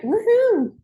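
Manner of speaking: very high, loud woohoo; excited whoop. Real, not acted. Host celebrating with Cheryl, whooping loudly. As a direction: let out a big celebratory cheer. Cheering after the chant.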